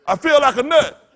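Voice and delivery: loud, laughing mock voice for the sperm; goofy cartoon sperm, loud and laughing. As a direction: ham up a giddy sperm, laughing. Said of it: "this sperm talking about".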